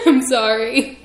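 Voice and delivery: slow, loud, laughing through the words; laughing apology. Real, not acted. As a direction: giggle out the apology.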